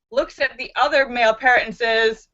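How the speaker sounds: usual speaking voice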